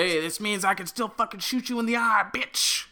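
high, cocky battlefield taunt; mock taunt. English longbowman jeering the French, mock taunt. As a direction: crow it at a beaten enemy.

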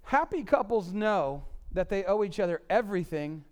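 ordinary talk, nothing marked

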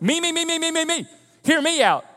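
high, loud chant of a needy egotist; hammed-up "listen to me" outburst; mock self-centred demanding. Parodying self-centred demands in conflict.

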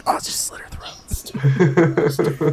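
slow, hushed whisper; whispered urging. Retold or imitated. Viewer urging Arya on, slow whisper. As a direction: whisper it slowly, egging her on. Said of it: "you're just like".

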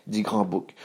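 ordinary delivery

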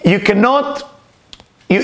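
normal talking voice

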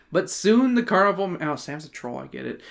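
ordinary delivery

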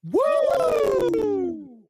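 a high, loud whoop; excited woo. Real, not acted. Host greets The Wrestling Hound in chat, loud excited whoop. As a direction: cheer the name like a wrestling crowd. Hyping a chat member's name.

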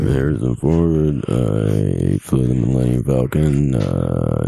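low, slow gravel-free Harrison Ford drawl; deep slow movie-star voice; Harrison Ford impression. Friend imitates Harrison Ford's voice, low and slow. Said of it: "Harrison Ford on A Good Day".

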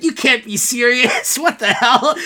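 high, laughing through the words; laughing quote. Real, not acted. Fan quoting Hawkeye while laughing, high and giggly. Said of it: "I'm laughing right now talking about it".

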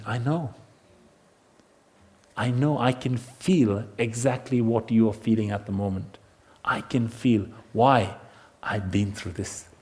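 low, soft, compassionate answer; tender reassurance. Acted. Jesus comforts someone going through insults, tender reassurance. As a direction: speak gently and low, with pauses to comfort.

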